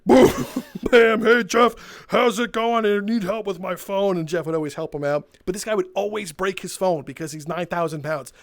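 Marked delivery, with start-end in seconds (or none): 0.0-4.2 loud, hammed-up hulking customer barging in; booming big-guy greeting. Huge customer greeting tech guy Jeff, loud booming hammed-up voice. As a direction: boom out the greeting like a huge excited regular.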